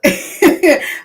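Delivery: short burst of high laughter. Real, not acted. Host cracking up before her greeting, high laugh.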